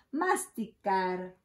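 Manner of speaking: ordinary delivery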